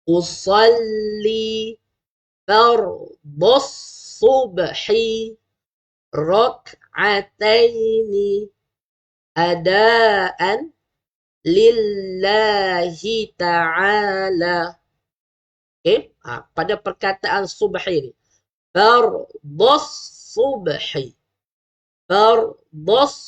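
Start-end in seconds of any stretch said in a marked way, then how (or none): 0.1-14.8 drawn-out, chanted Arabic intention; slow niat recitation. Shown as a demonstration. Teacher reciting the niat to parents, slow and chanted. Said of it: "cara bacaan dia macam mana?". 18.7-21.1 "subhi" with a thick sad, not sin; careful pronunciation. Shown as a demonstration. Teacher showing parents the sad sound, carefully. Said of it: "dia bunyi huruf sad".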